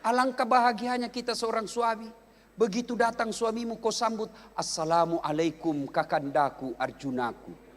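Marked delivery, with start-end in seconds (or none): 4.5-7.7 slow, low, tender greeting; mock-romantic, unhurried welcome; doting wife. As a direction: coo it lovingly to a returning husband, drawn out. Acted wife sweetly greeting husband.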